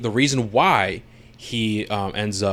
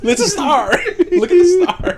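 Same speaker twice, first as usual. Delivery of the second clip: much higher through laughter; laughing; 5 dB louder than usual. Real, not acted. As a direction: squeal it out laughing. Laughing mock quote.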